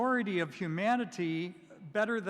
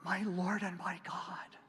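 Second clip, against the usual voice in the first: hushed, breathless confession; awed whisper; 4 dB quieter than usual. Retold or imitated. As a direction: breathe it out in awe.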